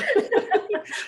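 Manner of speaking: high burst of laughter; amused high laugh; real laugh. Real, not acted. Laughs at the teasing.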